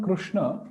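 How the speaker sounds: "Krishna" said as "Krushna"; u after r. Shown as a demonstration. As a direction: put a u vowel after the r in "Krishna". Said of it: "So this is not Krishna".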